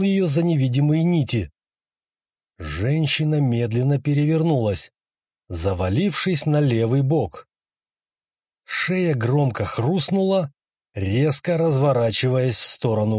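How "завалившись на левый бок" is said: ordinary delivery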